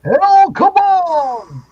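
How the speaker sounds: slow, loud, high cartoon call; El Capone. Dad quoting an old cartoon, a slow loud high call. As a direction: stretch it out big and loud like a cartoon. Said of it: "You don't remember El Capone".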